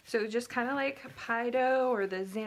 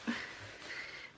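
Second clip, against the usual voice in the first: breathy laugh; 10 dB quieter than usual. Real, not acted. Overwhelmed by too much dough.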